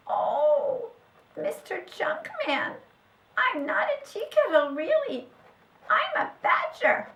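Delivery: high, squeaky explaining; tiny creature earnestly setting him straight; small, high-pitched badger; little badger voice. A narrator's reading. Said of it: "a little voice".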